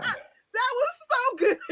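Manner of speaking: high squealed praise through laughter; delighted laughing. Real, not acted. Host praising the accent demo, delighted laughing. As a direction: gush it while cracking up.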